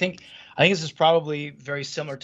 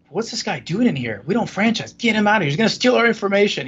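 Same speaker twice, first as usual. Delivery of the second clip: retold anger; 5 semitones higher than usual. Business partner Tafa objecting to the stranger in the office, mild mock anger. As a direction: bristle like an annoyed partner. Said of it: "He was actually mad".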